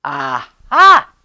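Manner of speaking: loud triumphant aha; aha cry. A narrator's reading. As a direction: cry it out on discovery. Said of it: "they cried".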